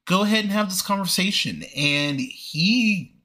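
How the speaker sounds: ordinary talk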